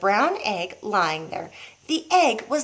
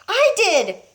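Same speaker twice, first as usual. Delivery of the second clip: put-on higher proud boy's voice; 11 semitones higher, 4 dB louder than usual. A narrator's reading. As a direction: own it brightly. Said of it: "George said".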